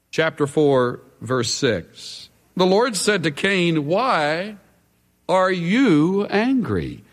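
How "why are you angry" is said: YOU drawn out, pause after "Why"; slow question, leaning hard on "you"; stress on YOU. Acted. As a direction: hit YOU, let "angry" fall away. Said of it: "the emphasis is on the pronoun".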